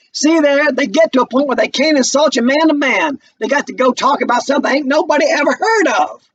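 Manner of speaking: put-on bitter young man jeering at a college boy; Walter taunting. Walter's voice again, retake.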